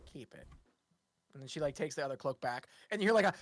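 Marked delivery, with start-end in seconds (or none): 0.1-0.5 soft, low-voiced handover; a hushed gift; quiet. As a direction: murmur it, letting go. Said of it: "she puts her hand on it and goes".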